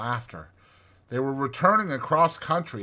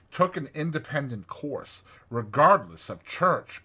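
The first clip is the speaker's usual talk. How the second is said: ordinary delivery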